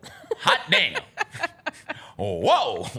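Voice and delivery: loud, high, laughing hot damn; hooting in shock at the picture; mock-shocked whoop with laughter; surprised whoop. Reacting to a surprise photo.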